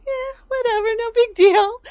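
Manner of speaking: high, loud, hammed-up shrug; exaggerated mock dismissiveness; imitating indifferent mom. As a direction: toss it off in a put-on high voice. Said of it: "would just shrug her shoulders like".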